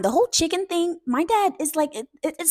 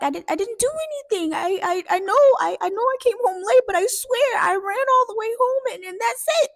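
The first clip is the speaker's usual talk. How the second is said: very high, whiny protest; desperate pleading; 10 semitones higher, 4 dB louder than usual. Retold or imitated. Dad swearing to grandma he did nothing, desperate. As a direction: imitate a kid frantically swearing innocence.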